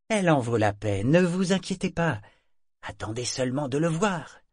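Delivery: calm him down with a promise; Mingsley reassuring. A narrator's reading. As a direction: merchant reassuring his grumbling companion. Mingsley answers Fjord.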